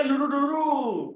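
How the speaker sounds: slow "लुरु, लुरु", last u held long; drawn-out sounding of "लुरु" for pupils; pronunciation demo. Shown as a demonstration. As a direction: model "लुरु" slowly, stretching the end. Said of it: "हिचं pronunciation कसं आहे".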